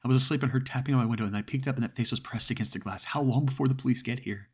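high, loud and rushed with terror; panicked caller. Acted. Frightened woman telling the dispatcher about the face, panicked. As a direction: plead for help as if something is at the window. Terrified caller on emergency call.

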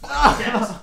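high, loud cheer; loud celebratory holler; excited echo. Real, not acted. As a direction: whoop it right back. Echoes the celebration.

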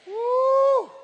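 loud high joyful whoop. Real, not acted. Preacher whooping after the prayer, loud and high. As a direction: let out a celebratory woo.

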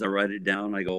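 ordinary talk

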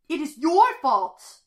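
high, slow, loud accusation; accusing shout. A narrator's reading. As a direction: shout it, hitting each word. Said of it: "a red-haired teenager shouts".

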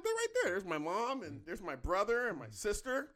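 high-pitched comic protest, rising on each item; defensive protest. Retold or imitated. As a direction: point them out, mock-indignant. Defends his photos to roommate.

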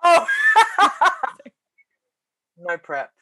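ordinary talk

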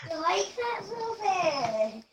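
shrill high-pitched scream. Acted. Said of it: "What was that screaming nonsense?".

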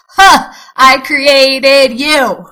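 a fond chuckle, as God answering; God chuckling at the speaker's question. Retold or imitated. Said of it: "he chuckles".

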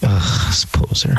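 low, slow scornful whisper; contemptuous aside. Retold or imitated. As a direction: sneer at a fake under your breath. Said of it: "I'm like".

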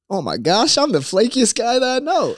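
high, loud comic dismay; exaggerated hurt. Admitting being flaky to friends, hammed-up dismay. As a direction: wail it in mock self-reproach. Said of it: "I was so hurt".

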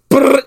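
loud, high imitated burst of gunfire; spray the sound like a machine gun; gunfire noise.

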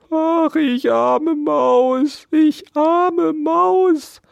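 very high, loud, drawn-out wail; lamenting mouse. A narrator's reading. Mouse bemoans the hailed-out harvest, lamenting. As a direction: wail slowly like a pitiful mouse. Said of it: "klagte Mariechen Knusperkorn".

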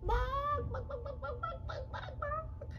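high squawky hen "bawk"; rapid run of high hen clucks; hen clucking. Acted. Henny Penny reacts to the apple, clucking. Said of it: "she said".

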